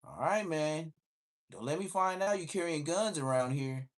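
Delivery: half-joking stern warning; officer warning the teen about guns, mock stern; imitating the cop. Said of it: "he'd be like".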